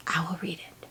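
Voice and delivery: whispered hope; hopeful whisper. Real, not acted. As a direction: whisper it like a wish. Hopeful whisper about reading book.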